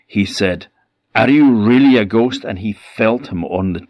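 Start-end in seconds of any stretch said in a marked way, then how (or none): 1.1-2.4 angry. Acted. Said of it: "the miser's ghost was getting furious".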